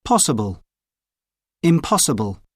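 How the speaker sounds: ordinary talk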